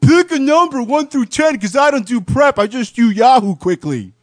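high, hammed-up co-host caricature; exaggerated high impression of a lazy co-host; Ryan. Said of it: "I'll do my best Ryan impression".

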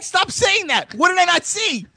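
high, frustrated mock yell; exasperated shout. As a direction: shout it back fed up. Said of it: "I'm like".

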